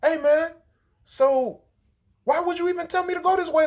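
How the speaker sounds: high, loud mock complaint; spazzing on her. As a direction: imitate him going off at his girlfriend. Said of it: "he's spazzing on her like".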